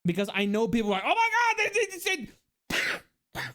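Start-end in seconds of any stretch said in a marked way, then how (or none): 1.0-3.4 hammed-up high-pitched pearl-clutching; mock outrage. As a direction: squeal in fake shock and stammer into nonsense. Mimics outraged people clipping the stream.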